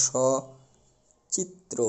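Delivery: সচিত্র said slowly with a clear open অ and a palatal শ sound, like শচিত্র; slow careful demo. Shown as a demonstration. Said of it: "উচ্চারণটা এরকম হবে". (সচিত্র ধীরে, শ-এর মতো উচ্চারণ)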